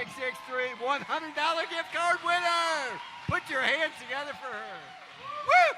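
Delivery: loud, high crowd hype; excited shouting. Real, not acted. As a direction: pump the crowd up for the winner. Hyping the hundred dollar winner.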